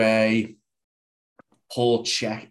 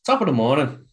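attempted Irish accent; 3 semitones higher, 4 dB louder than usual. Shown as a demonstration. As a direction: have a go at an Irish lilt. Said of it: "Give us your best Irish accent".

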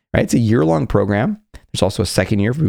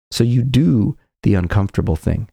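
ordinary delivery

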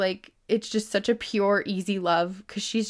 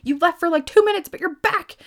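high, loud, overjoyed dog voice; voicing the dog; 10 semitones higher, 6 dB louder than usual. Speaker voicing her dog greeting her at the door, high and overjoyed. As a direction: squeal it like a dog thrilled you're home. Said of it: "they're just like".

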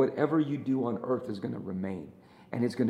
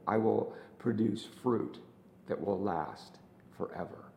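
led prayer; 3 semitones lower, 4 dB quieter than usual. Real, not acted. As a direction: pray it gently, line by line. Leads a prayer.

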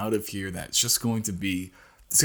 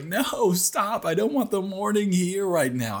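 mock protest; 8 semitones higher than usual. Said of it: "he's like".

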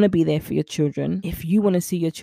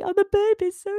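put-on cooing at a baby. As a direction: melt over the baby in a much higher voice.

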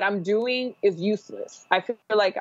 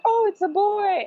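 high, delighted squeal of surprise; light up at the news; excited discovery; 13 semitones higher than usual. Retold or imitated. New mother tells listener she discovered a son, excited.

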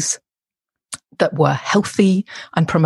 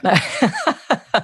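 light, amused laugh from the guest; real laughter; 4 semitones higher than usual. Real, not acted. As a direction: laugh it off, a bit embarrassed. Guest laughs at queen joke.

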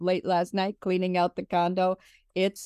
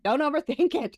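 bright high-pitched echo; playful high echo; 9 semitones higher than usual. Real, not acted. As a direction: repeat it up high, amused. Echoing it back, laughing.

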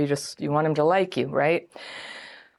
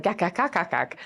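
short clacking nonsense syllables; mock chatter; 3 dB quieter than usual. People in her twenties handing out advice, mocking chatter.

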